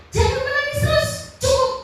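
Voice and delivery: suara tinggi dan lebih keras, meniru suami; suami menenangkan. Retold or imitated. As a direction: tirukan suami yang membujuk istri berhenti menangis. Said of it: "Suami saya bilang begini". (suami membujuk istri yang menangis, nada tinggi)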